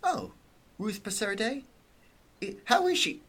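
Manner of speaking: high, startled New York lady; surprised. Acted. Marianne hears the police ask about Ruth, high startled surprise. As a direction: pitch up in alarmed surprise.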